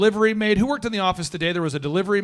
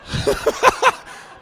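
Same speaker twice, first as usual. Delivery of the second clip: high breathy laugh. Real, not acted. Laughs mid-story.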